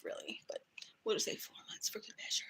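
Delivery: whispering a quick side confession to viewers; whispered aside. Real, not acted. Confiding aside mid-rant.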